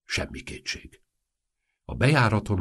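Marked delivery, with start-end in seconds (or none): none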